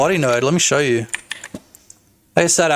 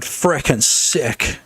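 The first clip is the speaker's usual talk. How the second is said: slow, drawn-out catchphrase; much slower than usual. Shown as a demonstration. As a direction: drag out frickin' sick slowly. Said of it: "I'll get the word".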